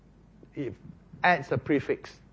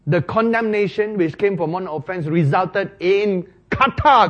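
louder, higher preacher's build; forceful preaching; 4 semitones higher, 7 dB louder than usual. Acted. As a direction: drive the point home from the pulpit. Preacher rising to full force.

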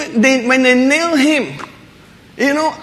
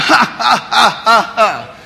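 mocking laughter. Mocking crowd at the cross.

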